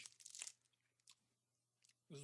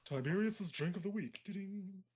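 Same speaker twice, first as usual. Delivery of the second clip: mock jingle announcement; 6 semitones higher, 6 dB louder, slower than usual. Mock radio segment announcement.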